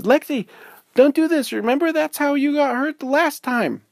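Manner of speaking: very high, loud scolding; scolding warning. Acted. Izzy warning Lexi, high and loud. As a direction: warn her like a worried friend.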